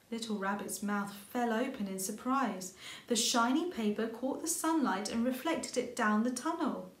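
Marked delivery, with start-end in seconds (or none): none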